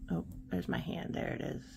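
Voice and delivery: a soft whisper to herself; whispered aside. Real, not acted. Whispering to viewers as her hand shows on camera. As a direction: breathe it out under the breath.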